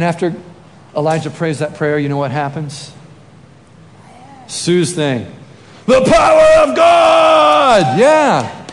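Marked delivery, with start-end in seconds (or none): 5.9-8.7 huge, high, drawn-out holler; full-force exultant yell; triumphant shout. Acted. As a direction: belt it out slowly like a superhero cry. Bursts out at the prayer's result.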